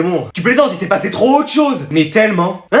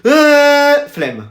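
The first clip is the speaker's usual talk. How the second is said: lazy refusal; 5 semitones higher than usual. Acted. Pupil asked to keep working, lazy refusal.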